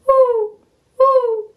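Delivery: high hooting owl call. Acted. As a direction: hoot like an owl. Sonido de la lechuza. (ulular agudo de lechuza)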